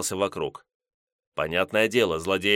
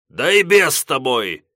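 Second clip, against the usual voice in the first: loud, higher exasperated shout; angry; 5 semitones higher, 7 dB louder than usual. Acted. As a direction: lose patience and yell it. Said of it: "разозлился на такое поведение Комтур".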